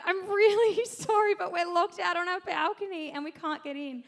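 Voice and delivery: high, mock-desperate shouted apology; apologetic calling out. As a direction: call an embarrassed apology across the balconies. Calling to neighbour, embarrassed apology.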